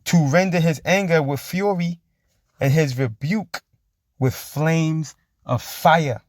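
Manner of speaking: very slow, drawn-out verse reading; preached scripture. Acted. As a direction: stretch each word like a proclamation. Reads scripture aloud.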